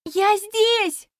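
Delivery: high, loud happy call; joyful shout. A narrator's reading. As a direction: wave and call out. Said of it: "закричала я".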